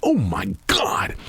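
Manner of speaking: slow, breathless whisper of amazement; hushed slow oh my God; awed whisper. Retold or imitated. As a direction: whisper it like you just heard a revelation. Awed reaction to expert's answer.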